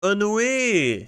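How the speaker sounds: "Ennui" loud and high, stretched on the last syllable; exaggerated echoed pronunciation. Copying a pronunciation clip, "Ennui" loud, high and drawn out. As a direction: hammer out "Ennui" with a long, falling "-wee".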